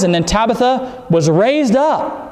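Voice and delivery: ordinary talk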